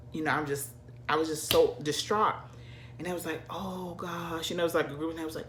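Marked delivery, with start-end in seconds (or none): 3.5-4.5 hushed, much softer "Oh gosh"; soft, sympathetic aside; soft sympathy. Retold or imitated. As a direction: murmur it in quiet dismay. Group reacting to lost wallet.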